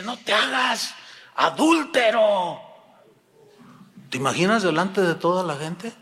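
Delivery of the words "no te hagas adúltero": higher pitch, drawn-out final word; public accusation. Retold or imitated. Pastor accusing a man before his congregation, denouncing.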